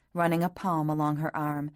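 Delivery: ordinary delivery, nothing marked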